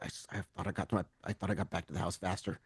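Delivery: low, hushed mumble under the breath; very soft, low, muttered regret; mumbling. Acted. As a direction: mumble it low, almost to yourself. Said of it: "you hear him mumble".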